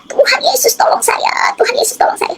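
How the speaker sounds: high, thin, hushed imitation of screaming; retold tearful cry for help. Woman retelling her prayer in agony, high hushed mock cry. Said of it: "saya teriak".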